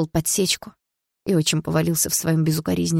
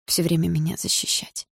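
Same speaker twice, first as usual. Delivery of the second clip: plain reading voice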